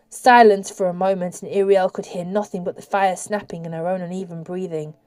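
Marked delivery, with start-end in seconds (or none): none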